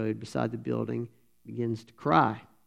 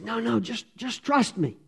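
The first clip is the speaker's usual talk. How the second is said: much higher, calm reassurance; young man soothing a frightened kid; reassuring; 9 semitones higher than usual. Acted. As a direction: settle the boy's panic.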